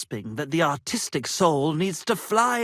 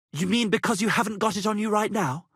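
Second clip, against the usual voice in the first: ordinary delivery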